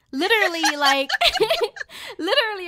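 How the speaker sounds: laughing through the words in embarrassment; breaking into a laugh mid-sentence; giggling while telling it. Real, not acted. Embarrassed laughing at lipstick story.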